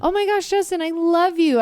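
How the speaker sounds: high, bright and gushing; delighted greeting. Retold or imitated. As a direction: greet him like you missed him. Excited greeting of husband.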